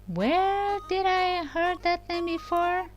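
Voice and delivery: high, put-on wondering voice; exaggerated pondering; mock puzzlement. Pretends to puzzle over the name.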